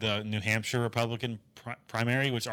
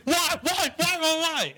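mock angry Biden; slower than usual. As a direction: hammer each why in fake outrage. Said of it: "He's like".

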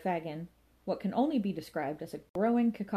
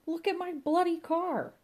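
much higher, strained outraged wail; outraged cry; 12 semitones higher, 3 dB louder than usual. A narrator's reading. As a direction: howl in disbelief at the ruined car. Inspector sees his wrecked car.

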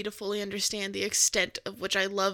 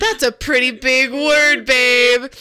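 put-on slow, drawn-out condescending boyfriend; smug hipster talking down; smug TikTok guy; 8 dB louder, slower than usual. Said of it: "he just goes".

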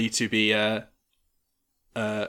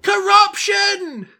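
high, slow, loud cry of outrage; outraged shout; 11 dB louder, slower than usual. Acted. A townsman shouting at the crowd in outrage. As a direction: bellow it like an angry townsman. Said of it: "somebody saying".

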